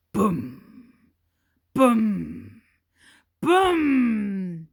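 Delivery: loud, heavy booms growing; booming footsteps. A narrator's reading. Narrator sounding the giant's approaching steps, loud booms. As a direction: stamp each boom like a giant's tread.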